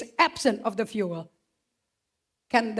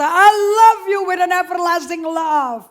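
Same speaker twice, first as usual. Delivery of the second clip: impassioned declaration; 8 semitones higher, 13 dB louder, slower than usual. Acted. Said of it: "dia berkata".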